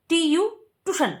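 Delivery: ordinary talk, nothing marked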